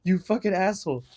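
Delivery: affectionate mock anger at a friend; mock exasperation. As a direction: scold her playfully, stretching it out. Retorting to friend's mistake.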